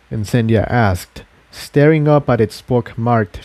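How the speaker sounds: ordinary delivery, nothing marked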